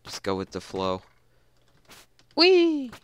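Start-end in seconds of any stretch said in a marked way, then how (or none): 2.4-2.9 very high, excited squeal; gleeful whee. Acted. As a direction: squeal with glee mid-jump. Cat leaps off furniture.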